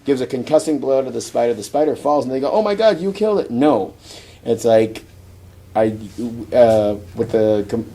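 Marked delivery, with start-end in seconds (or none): 2.5-3.5 high-pitched imitated dismay; mock shock. Teammates see the spider fall, mock shock. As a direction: play the horrified onlookers lightly. Onlookers react to fallen spider.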